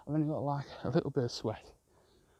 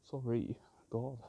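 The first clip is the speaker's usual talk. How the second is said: ordinary talk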